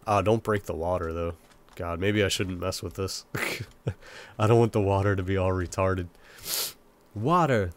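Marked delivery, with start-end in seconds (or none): none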